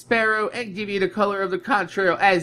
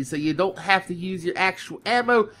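usual speaking voice

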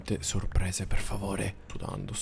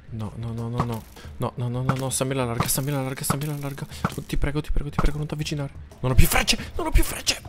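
rising, louder frightened begging; pleading in fear; 5 semitones higher, 3 dB louder than usual. Real, not acted. Gamer out of arrows begging monsters, frightened pleading. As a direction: beg the monsters to stay away.

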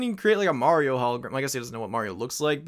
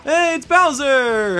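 mock excited; 12 semitones higher, 9 dB louder, much slower than usual. Player hypes Bowser's entrance.

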